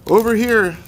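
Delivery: put-on high call with a Russian accent. A narrator's reading. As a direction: shout back lightly in a Russian accent. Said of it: "said someone with a Russian accent".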